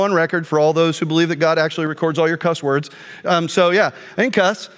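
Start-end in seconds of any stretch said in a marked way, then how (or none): none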